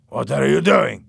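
loud, sharp suspicious question; suspicious villain. Acted. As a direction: demand it suspiciously. Louis suspicious.

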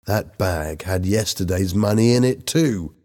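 usual speaking voice

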